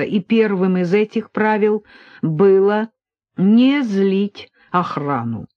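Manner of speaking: usual speaking voice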